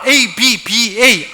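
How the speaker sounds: 에이 비 비 에이 spelled letter by letter, slow; spelled out slowly. Shown as a demonstration. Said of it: "정확한 게 발음이".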